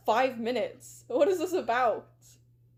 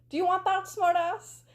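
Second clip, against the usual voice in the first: raised-pitch taunting challenge; acted threat; 6 semitones higher than usual. Connie threatening a caller on the phone, acted exasperation. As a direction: throw it down as a heated dare.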